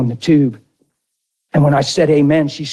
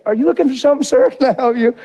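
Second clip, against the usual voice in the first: light store clerk voice; 10 semitones higher, faster than usual. Retold or imitated.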